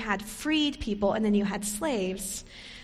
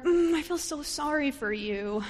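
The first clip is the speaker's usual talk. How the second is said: hollow put-on pity; condescending pity aimed at a young girl; fake pity; 4 semitones higher than usual. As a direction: sound sorry without meaning it. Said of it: "She doesn't show sympathy".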